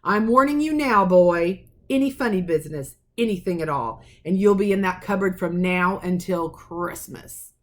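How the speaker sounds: put-on loom over the boy and threaten; furious uncle warning. Vernon threatens Harry.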